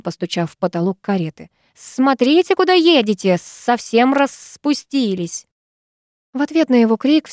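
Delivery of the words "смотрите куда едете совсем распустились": higher, scolding shout; angry yell. Acted. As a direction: bark at the careless drivers. Nag yells at drivers.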